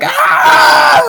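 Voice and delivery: loud, very high scream; mock scream. Retelling how they run out, high mock scream. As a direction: scream like you're fleeing in panic, hammed up. Acts out them running out screaming.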